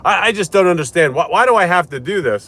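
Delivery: a lightly hammed-up confused old lady; whiny patient.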